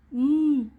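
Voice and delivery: म hummed with lips closed; phonic m sound. Shown as a demonstration. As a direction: say म with the mouth shut. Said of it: "मुंह बंद करके बोलना है".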